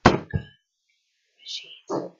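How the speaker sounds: breathy unvoiced whisper; male whisper. Mysterious caller whispering down the phone line. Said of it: "a male whisper".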